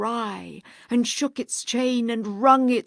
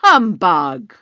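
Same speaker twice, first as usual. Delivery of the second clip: "Humbug" shouted much louder, rising at the end; 14 dB louder than usual. Acted. Scrooge repeats louder.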